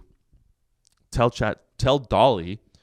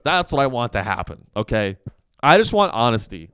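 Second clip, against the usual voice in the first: ordinary talk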